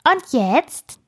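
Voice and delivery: high, cheeky squirrel voice; smirking. A narrator's reading. Squirrel teases right by the girl's ear, smirking. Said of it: "feixte es ganz nah an ihrem Ohr".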